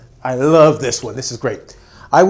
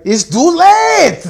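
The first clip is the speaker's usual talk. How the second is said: much higher, loud, slow stern verdict; mock German strictness; 14 semitones higher, 12 dB louder, much slower than usual. German business partner refusing the late arrival, hammed-up high and slow. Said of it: "They're like".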